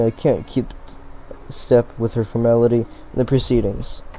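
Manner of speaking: ordinary talk, nothing marked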